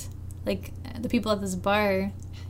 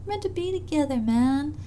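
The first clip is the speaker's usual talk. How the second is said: dreamy high voice; 9 semitones higher than usual. Retold or imitated. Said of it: "I think she's thinking".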